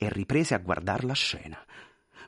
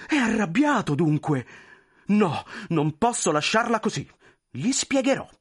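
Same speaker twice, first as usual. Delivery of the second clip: much higher, pleading, flustered voice; anxious clerk; 9 semitones higher, 3 dB louder than usual. Acted. Clerk pleading with the general, high and flustered. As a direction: beg forgiveness nervously.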